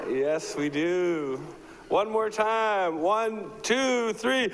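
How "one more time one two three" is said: counting up higher and higher to rally the room; hyped countdown. Real, not acted. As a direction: build the crowd toward the cheer, pitch climbing. Hyping the crowd for a cheer.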